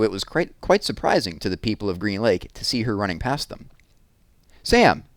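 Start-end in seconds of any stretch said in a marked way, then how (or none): 4.6-5.0 high, loud shouted name. A narrator's reading. Mrs Tennyson running after Sam, shouting his name. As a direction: shout after him down the street. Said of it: "she shouted".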